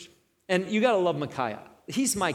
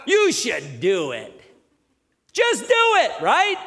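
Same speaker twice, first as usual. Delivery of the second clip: high, loud sneering jab; mocking; 7 semitones higher, 7 dB louder than usual. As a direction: toss it off with scorn. Mocking the prophets' advice.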